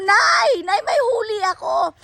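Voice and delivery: high excited shout, calling across the river; calling out to mom. Retold or imitated. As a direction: yell for mom, thrilled with the catch. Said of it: "sisigaw ako". (sumisigaw kay Nanay, tuwang-tuwa)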